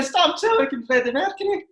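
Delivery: high, laughing squeal of disbelief; retold with a laughing, high-pitched squeal. Retelling disbelief at the substitutions.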